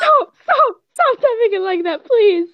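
high, loud pleading shout; frantic shouting. Real, not acted. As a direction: yell at him to stop. Shouting at vendor on video.

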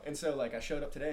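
ordinary delivery

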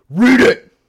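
retold scream, very loud and high; screamed order. Woman screaming at a diner to read her paper, screamed. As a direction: imitate a furious screamed command. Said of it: "And then she screamed at me".

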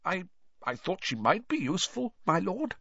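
high, timid stammering servant; nervous stammer. A narrator's reading. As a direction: cower before your master.

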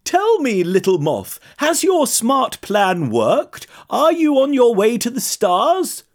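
high, curious giraffe voice; friendly giraffe. A narrator's reading.

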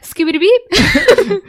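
high, loud and drawn-out; silly mock voice. Podcaster pitching an absurd killer's line, silly high voice. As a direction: squeal it out as a goofy joke.

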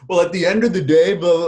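lightly mocking contestant voice; mock reality contestant. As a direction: toss off the cliché like a contestant. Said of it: "and now they all say".

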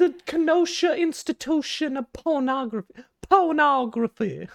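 clutch your pearls, swooning; Southern belle with vapors. Pretend. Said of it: "I'm getting the vapors".

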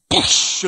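loud imitated burst of light; explosive whoosh. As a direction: burst out a hissed explosion noise.

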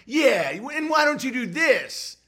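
high, slow, sneering mimicry of a nagging chatter; mock the pestering viewer, drawn out and high; mocking whiny viewer. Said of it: "being like".

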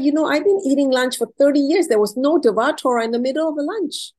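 high, loud, fast outburst of astonishment; retold tearful amazement. New convert retelling her amazement at a lunch, exclaiming loud and fast. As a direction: exclaim in a rush, re-enacting being overwhelmed. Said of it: "And I broke into tears. I said".

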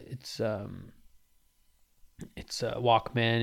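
ordinary delivery

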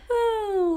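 sharp gasp into a high ooh; surprised gasp. Real, not acted. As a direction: gasp as if caught off guard. Reacting to story twist.

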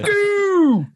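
high, loud "Dude!"; excited shout. Real, not acted. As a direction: yelp it out in delight. Excited outburst.

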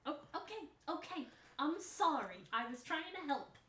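high, loud, fast defensive backpedal; flustered apology. Acted. Bickering in character after cat voice.

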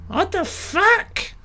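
genuinely thrown by what's on screen; real startled disbelief; shocked. Real, not acted. Reacts to the monster on screen.

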